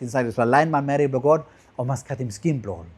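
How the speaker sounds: usual speaking voice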